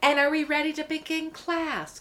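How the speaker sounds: high, sing-out classroom opener; mock teacher. Officer turning back to the offenders, high mock-teacher delivery. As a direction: switch abruptly into a pleasant teacher voice.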